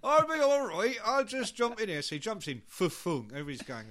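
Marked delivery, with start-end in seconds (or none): 0.0-2.0 high, loud, breezy West Country; West Country accent. Acted. Drummer squeezing into the van, breezy West Country accent. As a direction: brush it off brightly in West Country. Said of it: "he's from, like, the West Country".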